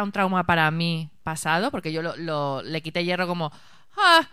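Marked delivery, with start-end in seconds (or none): 4.0-4.3 joking brush-off. Retold or imitated. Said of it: "tu respuesta siempre del humor".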